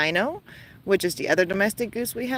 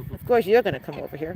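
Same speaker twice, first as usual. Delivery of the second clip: normal talking voice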